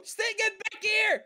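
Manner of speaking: high excited shouts at a fish; talking to fish. Acted. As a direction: call the fish back eagerly. Calling after fleeing fish.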